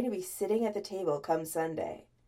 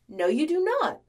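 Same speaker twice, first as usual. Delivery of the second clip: loud, high, indignant denial; forceful contradiction; 7 semitones higher, 6 dB louder than usual. A narrator's reading. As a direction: flatly refuse his excuse, voice raised. Contradicting him in phone argument.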